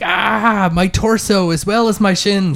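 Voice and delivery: high-pitched theatrical wail; pained cry. Acted. Game master voicing a wounded enemy, crying out high. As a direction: cry out like a wounded villain, hammed-up. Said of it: "he just kinda cries out".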